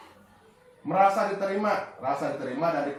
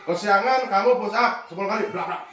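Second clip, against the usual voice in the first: quick, louder punishing order; snap verdict; 4 semitones higher, 5 dB louder than usual. Shown as a demonstration. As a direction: hand down the push-ups at once. (vonis cepat dan keras)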